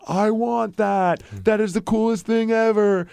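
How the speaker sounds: imitated high, excited child; awestruck kid. Imitates kid wanting the Ted doll.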